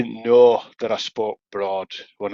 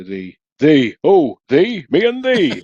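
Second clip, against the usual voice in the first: Yorkshire dialect, rising higher and louder; Yorkshire accent; 5 semitones higher, 7 dB louder, slower than usual. Shown as a demonstration. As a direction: show off broad Yorkshire thee. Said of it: "We say".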